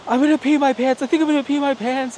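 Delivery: high, loud, fast excited squeal; giddy panic. Retold or imitated. Fellow rider on Valravn, excited high squeal. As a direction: squeal like you might wet yourself mid-ride. Excited guy on roller coaster.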